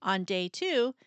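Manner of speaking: ordinary delivery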